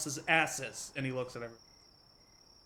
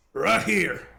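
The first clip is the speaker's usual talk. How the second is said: loud shout from off to the side; calling out; 5 semitones higher, 8 dB louder than usual. Acted. Farmer shouting to the party from the cabin. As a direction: call out to be found.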